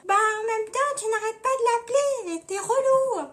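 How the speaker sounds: high, singsong mock annoyance; high-pitched exasperated character voice. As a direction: whine it in a high put-on voice. Run-through of [name]'s annoyed reply.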